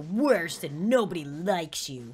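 slow, drawn-out mean taunt; Savage Patrick. Acted. Patrick bullying the yodel kid, slow savage taunting. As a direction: taunt slowly, letting each insult land. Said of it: "He's like".

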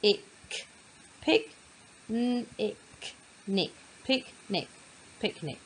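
P, ick, pick said as split sounds, then blended into picnic; sounding out. Shown as a demonstration. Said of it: "sound out the first part".

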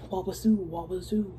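slithering chant. Acted. Python slithering sound.

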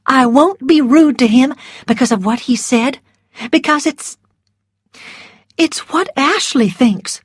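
firm but gentle resolve; a hint of brave Melanie. A narrator's reading. Melanie voiced in story.

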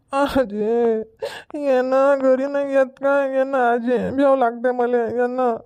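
put-on high, whining child in tears; tearful, frightened child. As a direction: beg grandma to come, crying. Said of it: "रडू नको". (घाबरून रडत विनवणारं मूल)

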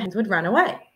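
plain reading voice

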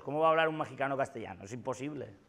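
usual speaking voice

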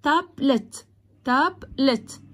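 "تابلت" split into tab and let; a small gap inside each "تابلت"; two split syllables. Shown as a demonstration. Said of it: "الكلمات اللي على مقطعين زي ايه". ("تابلت" متقطعة تاب ثم لت)

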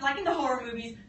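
ordinary delivery

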